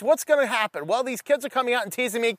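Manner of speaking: normal talking voice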